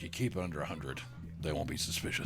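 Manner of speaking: a hint of a low, scheming cult leader. Host voicing the cult leader's secret advice, low scheming voice. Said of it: "He's like".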